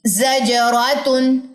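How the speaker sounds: जजरातुन drawn out, each syllable clear; जजरातुन, slow and deliberate; slow model reading. Shown as a demonstration. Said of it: "next चलिए". (धीमा आदर्श उच्चारण, जजरातुन)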